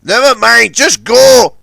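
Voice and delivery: loud urgent plea; begging. Acted. Big Mac begs Puffer to fetch help, loud. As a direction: push him off, pleading. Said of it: "begged Big Mac".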